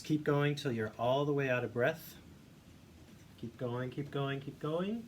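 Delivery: normal talking voice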